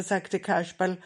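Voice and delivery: usual speaking voice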